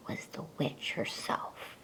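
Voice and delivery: ordinary delivery, nothing marked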